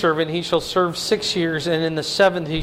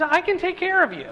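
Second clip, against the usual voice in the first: higher, gentle voicing of God; God speaking; 11 semitones higher than usual. Preacher voicing God's care to listeners, lightly higher. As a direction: lift it as God's reassurance. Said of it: "God says".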